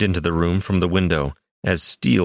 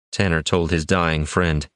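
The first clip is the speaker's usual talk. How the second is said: usual speaking voice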